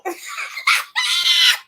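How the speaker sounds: shrieking laughter; loud high-pitched cackle. Real, not acted. Bursting out laughing.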